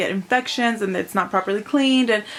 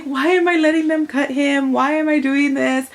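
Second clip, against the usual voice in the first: higher, pained self-questioning; a mother's guilty inner cry, pitched up; guilty anguish; 7 semitones higher, 4 dB louder than usual. Retold or imitated. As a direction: plead with yourself, full of guilt. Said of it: "I felt so guilty".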